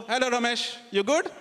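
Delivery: high-pitched imitated Sri Lankan accent; Sri Lankan uncle. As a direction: greet him in a put-on Sri Lankan accent. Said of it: "So I'll meet an uncle and go".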